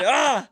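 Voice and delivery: loud, high short cry; imitated outburst. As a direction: mimic a sudden loud outburst. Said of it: "the dude said".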